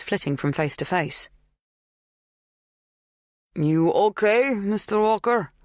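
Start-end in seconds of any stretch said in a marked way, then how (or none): 3.5-5.5 put-on strong Polish accent, raised pitch, stress on okay; thickly accented Polish man asking in concern; strong foreign accent. A narrator's reading. Polish farmhand to an unexpected visitor, strong accent. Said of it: "His accent was strong, the emphasis on okay".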